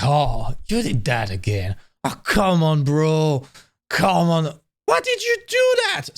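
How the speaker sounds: annoyed protest climbing into a high-pitched cry; mock fed-up complaint, pitch shooting up at the end; acted exasperation. Fed-up person scolding a repeat offender, acted exasperation. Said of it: "you get pissed nonstop by that person".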